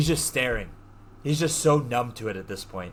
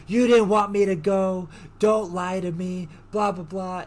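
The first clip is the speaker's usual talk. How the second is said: mild mock scream, pitched up; retold screaming; 5 semitones higher, 4 dB louder than usual. Girlfriend accusing boyfriend of lying, mild mock scream. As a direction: imitate an upset accusation, raised but held back. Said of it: "And she starts screaming".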